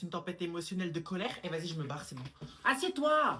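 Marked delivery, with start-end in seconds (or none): 2.6-3.4 sharp order. Acted. Mother orders daughter to sit.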